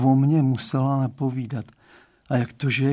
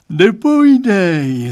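drawn-out, high and loud yawned word; yawning; 6 semitones higher, 11 dB louder, slower than usual. A narrator's reading. Father yawning at the boy's answer. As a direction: stretch it wide into a yawn. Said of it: "zívnul pan Stránský".

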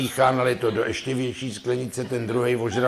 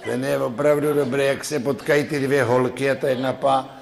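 usual speaking voice

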